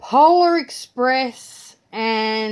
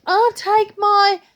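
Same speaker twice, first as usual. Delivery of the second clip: put-on high, slow little-girl voice; granddaughter voice; 12 semitones higher, 7 dB louder, slower than usual. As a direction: play a small child announcing her choice. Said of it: "She goes".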